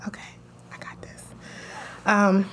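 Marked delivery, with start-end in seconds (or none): 0.1-1.2 whispered pep talk under her breath; steady yourself in a whisper; whispered to herself. Real, not acted. Legislator calming herself mid-interview, whispered. Composing herself after nearly crying.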